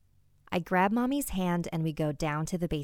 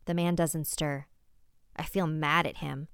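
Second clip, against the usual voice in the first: ordinary delivery, nothing marked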